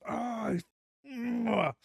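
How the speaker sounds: ordinary talk, nothing marked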